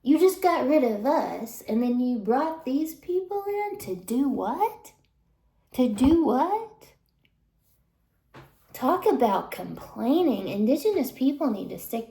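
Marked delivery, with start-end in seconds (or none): 0.0-6.7 indignant. Retold or imitated. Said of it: "natives were looking at the slaves like".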